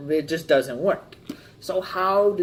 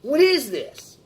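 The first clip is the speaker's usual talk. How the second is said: higher, louder, quick indignant outburst; offended disbelief; 11 semitones higher, 6 dB louder than usual. Retold or imitated. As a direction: react like you've been handed garbage. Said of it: "They'd be like".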